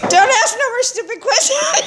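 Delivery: high, laughing snap back; laughing at yourself while scolding; laughing retort. Retold or imitated. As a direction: toss it off through laughter. Snapping back at own head.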